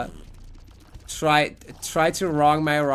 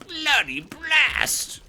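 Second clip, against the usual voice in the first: high, drawn-out caricature of a curse; mock English swearing; 11 semitones higher, much slower than usual. Streamer mocking English people swearing, high drawn-out caricature. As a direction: squeal the curse slowly in mockery. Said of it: "English people swearing twice be like".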